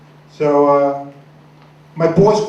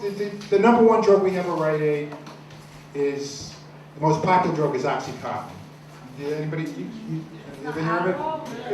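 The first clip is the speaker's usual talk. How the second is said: ordinary delivery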